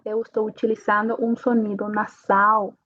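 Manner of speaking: ordinary talk, nothing marked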